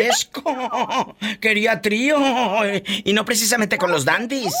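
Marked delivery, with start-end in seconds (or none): 1.2-4.6 laughing. Real, not acted. Host jokes, laughing.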